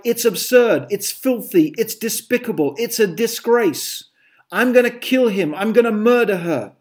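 high, loud mock indignation; dramatic outrage. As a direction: play the outraged hothead. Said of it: "I'm being dramatic now".

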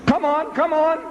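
high-pitched acted goading; impatient Reverend pressing for an answer; impatient urging. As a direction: hurry him along, pitched right up. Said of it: "started ba-, banging his feet".

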